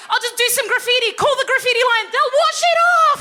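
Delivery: high, loud gleeful dad voice; delighted dad. Retold or imitated. Dad boasting about the graffiti line, high and gleeful. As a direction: gush like a proud rule-follower.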